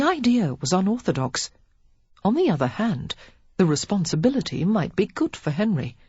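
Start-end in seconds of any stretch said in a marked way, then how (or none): none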